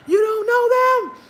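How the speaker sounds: high, loud mock scolding; loud high-pitched indignant quote; retold outrage. Voicing angry sister's reply.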